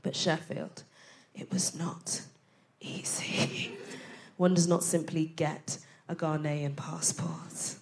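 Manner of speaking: hushed, ominous whisper; dramatic whisper. Acted. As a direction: whisper it like a dark warning.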